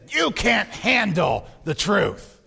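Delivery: slow, altered-voice retort; Jack Nicholson impression. Colonel snapping back at the lawyer, slow and forceful. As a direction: hammer it out slowly, dropping at the end. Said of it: "Nicholson says".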